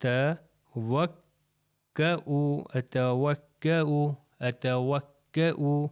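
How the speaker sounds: اتوکؤ said very slowly three times; teacher drills اتوکؤ for students; slow repeated demo. Shown as a demonstration. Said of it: "تو یہ ہو جائے گا".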